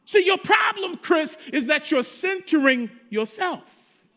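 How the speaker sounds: loud, emphatic sermon voice voicing God's reply; preaching at full force. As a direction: preach God's answer to the doubting pastor.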